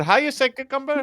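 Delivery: high-pitched fake Spanish accent; mock Spanish accent. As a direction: play a wife faking a Spanish accent. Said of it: "She said".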